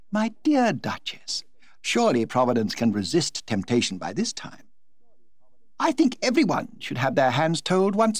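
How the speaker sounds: Lady Windermere chatters happily to the Duchess; gushing society hostess. Acted. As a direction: toss it off like a delighted society hostess.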